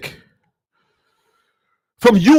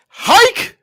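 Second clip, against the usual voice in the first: high sharp hike call; 12 semitones higher than usual. Retold or imitated. Quarterback shouting the snap, high and sharp.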